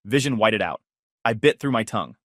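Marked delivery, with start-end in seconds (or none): none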